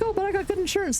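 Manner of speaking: mock-proud insurance brag; mocking smug American. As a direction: parrot them in a silly high voice. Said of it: "people are like".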